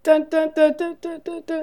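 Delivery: rapid high run of duns; cartoon sound effect. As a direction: imitate a video game effect with your voice. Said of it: "Give the sound effect".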